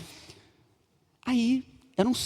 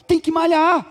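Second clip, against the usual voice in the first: loud exhortation; 7 semitones higher, 6 dB louder, slower than usual. Acted. Preacher exhorts loudly.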